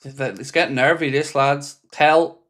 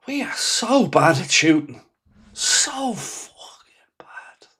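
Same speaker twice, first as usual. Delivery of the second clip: a sigh, then a slow broken whisper; despairing sigh; slower than usual. Real, not acted. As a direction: let it fall apart into a near sob. Fan despairing after wasted shot.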